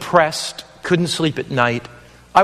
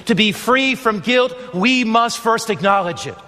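full-voiced evangelist preaching to viewers; 4 semitones higher than usual. Evangelist preaching to viewers at home, fervent sermon voice. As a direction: plead with the viewers, rising and falling.

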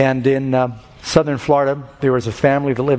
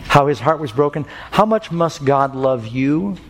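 usual speaking voice

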